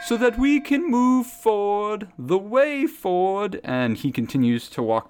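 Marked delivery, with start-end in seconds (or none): 0.1-3.6 high, drawn-out proclaiming; slow, high sermon cry over a gong; zealot preacher. Acted. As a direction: chant it like a street prophet. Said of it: "he bangs a gong".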